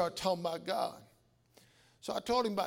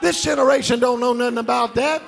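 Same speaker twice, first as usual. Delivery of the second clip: loud, rising sermon shout over music; loud gospel preaching; full-force preaching; 3 semitones higher, 11 dB louder than usual. Acted. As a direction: preach it big to the whole church.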